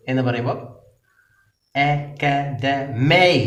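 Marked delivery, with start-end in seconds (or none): 1.8-3.3 അക്കാഡമൈ drawn out slowly with weight on മൈ; slow stress demo. Shown as a demonstration. Teacher drawing out അക്കാഡമൈ for students, syllable stressed slowly. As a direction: lean on the last syllable of അക്കാഡമൈ, unhurried. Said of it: "ആ സിലബിളിൻ്റെ മേലെ stress ചെയ്യുന്നു".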